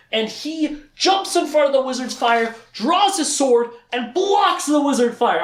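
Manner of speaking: high, punchy action storytelling; excited narration. A narrator's reading. Teller describes Richard blocking the fire, excited. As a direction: build up each heroic move.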